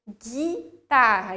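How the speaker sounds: guitarra slowly as ghi-tarra, no u sound; hard G, silent U. Shown as a demonstration. Teacher modelling the word guitarra, slow, U silent. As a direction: stretch guitarra with a plain hard g. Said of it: "se eu tiro o U".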